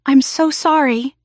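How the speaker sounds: drawn out, a hint of sobbing; choked apology. A narrator's reading. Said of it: "I choked out between sobs".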